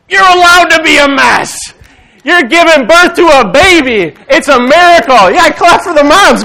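loud, high-pitched rallying preacher; full-force preaching. Acted. Preacher cheering on pregnant moms who are a mess, full-force preaching.

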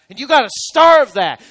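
ordinary talk, nothing marked

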